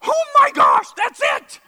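very high, loud shout of revelation; retold eureka yell; exclaimed outburst. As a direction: shout it out like a sudden discovery. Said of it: "I didn't do it like that".

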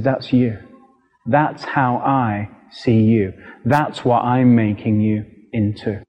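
low, slow, measured tenderness; tender reveal. Retold or imitated. Father revealing his vision to son, retold tenderly.

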